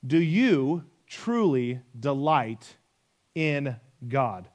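slow and deliberate, word by word; drawn-out, searching repeat of the question; slow, weighty question. Real, not acted. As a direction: let each word land, pausing before "in God".

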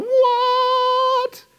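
very high, loud astonished cry; squeaky shocked what; crowd in disbelief. Retold or imitated. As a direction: yelp of amazed disbelief. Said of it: "imagine the crowd going".

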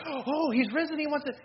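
high-pitched, acted amazement; mock astonishment. Peter hearing that Jesus has risen, acted surprise. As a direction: play a startled reaction to wonderful news.

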